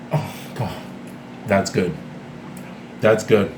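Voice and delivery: low savouring murmur of approval. Real, not acted. Cook tasting own dinner roll, low and savouring. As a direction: drop low, relishing the bite.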